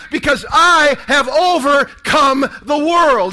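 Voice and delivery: loud, slow declaration; rousing shout. Acted. Preacher proclaiming Jesus' words, loud shout. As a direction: proclaim it to the crowd. Rallying the congregation.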